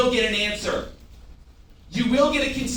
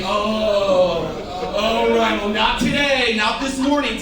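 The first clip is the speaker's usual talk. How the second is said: loud, theatrical lament; hammed-up, louder protest; mock exasperation; 5 dB louder, slower than usual. Shown as a demonstration. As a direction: wail it out in mock dismay. Models exasperated reply for the audience.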